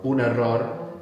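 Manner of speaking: "error" said e-RROR with the middle R rolled; rolled double R. Shown as a demonstration. Teacher repeating after the rule, rolled R in "error". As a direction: trill the double R in "error", stress the end. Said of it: "Double R tends to be rolled as well".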